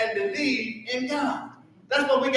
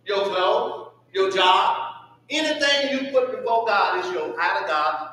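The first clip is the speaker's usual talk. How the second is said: usual speaking voice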